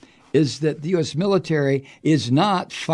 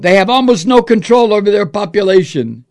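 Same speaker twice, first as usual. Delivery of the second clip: mock Trump quote; 6 semitones higher, 9 dB louder than usual.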